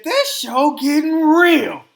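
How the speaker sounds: high, loud, drawn-out delight; slow it down and belt it, thrilled; excited. Real, not acted. Host thrilled by the drama on Girlfriends, loud excited exclamation.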